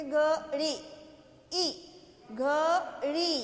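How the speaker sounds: घड़ी slowly, holding the final ee; drawn-out long vowel. Shown as a demonstration. Teacher showing children how to say घड़ी, last vowel drawn out. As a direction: split घड़ी and stretch ड़ी. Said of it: "खींच के बोलेंगे".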